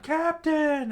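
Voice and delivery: high, loud cry for the captain; panicked yell. Acted. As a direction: shout in alarm for your captain. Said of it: "The Marine screamed out".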